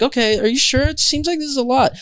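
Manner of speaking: high, fussing questioning voice; fretting boss asking questions; worried boss. Retold or imitated. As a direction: voice the doubtful boss.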